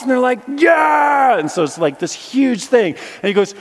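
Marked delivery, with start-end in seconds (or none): 0.5-1.4 high, loud shouted yeah; crowd roar. Retold or imitated. Crowd shouting yes to the walker, high loud roar.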